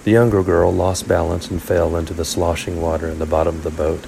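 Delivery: ordinary delivery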